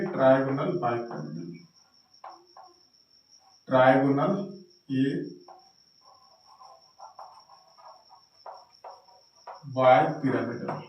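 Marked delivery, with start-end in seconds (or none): 3.7-10.8 slow, spelled-out term. Shown as a demonstration. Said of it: "तो हम इसको कहेंगे".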